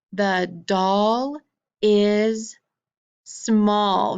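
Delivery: The doll, is, small, each word alone with pauses. Shown as a demonstration. Teacher modelling the sentence word by word. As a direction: set each word apart. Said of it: "let's say that together".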